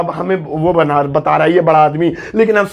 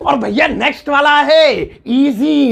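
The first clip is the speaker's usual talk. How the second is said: high, slow showman announcing; game-show style reveal; announcer intro; 7 semitones higher, slower than usual. Acted. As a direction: announce the next word like a host. Recurring segment announcer intro.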